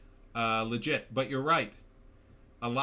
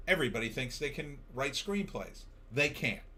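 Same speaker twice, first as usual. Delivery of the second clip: normal talking voice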